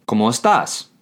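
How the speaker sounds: "¿Cómo estás?" with pitch climbing on "estás"; rising question inflection. Shown as a demonstration. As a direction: ask "¿Cómo estás?", voice lifting toward the end. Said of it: "what's called a rising inflection".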